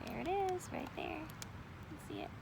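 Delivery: soft whispered pointing; hushed. A narrator's reading. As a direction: whisper it to the little listeners.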